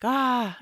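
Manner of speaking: short loud high "Ah"; a burst of stress in one loud yelp; frustrated yelp. Retold or imitated. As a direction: let out a sharp cry of frustration. Freaking out about being late.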